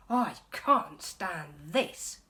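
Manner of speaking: loud, slow exasperated groan; loud grumpy complaint. Acted. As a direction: drag it out, fed up. Mister Bear exasperated.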